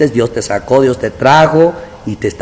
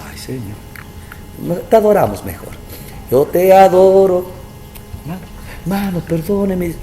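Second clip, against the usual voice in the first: high, hammed-up plea; mock tearful worship; 8 semitones higher than usual. Pastor mimicking weeping members begging forgiveness, high mock cry. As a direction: ham up the weeping congregants, voice up.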